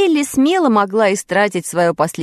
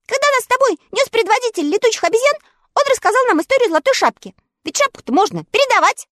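high, quick small dog; much higher quick puppy voice; little dog voice; faster than usual. A narrator's reading. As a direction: chatter like an eager little dog. Totoshka explains.

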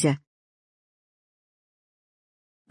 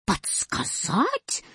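drawn-out, soft, high indignant question; squealing comma; 4 semitones higher, 8 dB quieter, much slower than usual. A narrator's reading. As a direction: stretch it out slowly in disbelief. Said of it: "завизжала запятая". (возмущённый тонкий голос запятой)

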